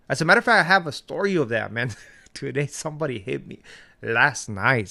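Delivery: ordinary delivery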